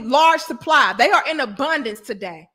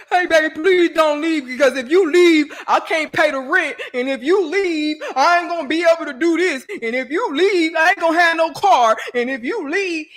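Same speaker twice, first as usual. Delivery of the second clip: pleading, higher and louder; mock begging boyfriend; 3 semitones higher, 4 dB louder than usual. Boyfriend begging his woman not to leave, pleading whine. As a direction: whine like a broke man begging. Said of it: "men that absolutely need us".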